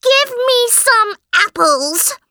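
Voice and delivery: high shrieking demand; screaming brat. Acted. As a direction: scream it like a spoiled child. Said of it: "screamed Helen".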